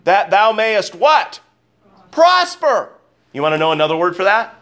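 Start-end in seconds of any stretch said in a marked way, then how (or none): 2.1-2.9 leaps way up, ringing; preacher's high exclaimed reply; exclaimed answer. Real, not acted. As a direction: shout the answer out triumphantly. Call-and-response answer.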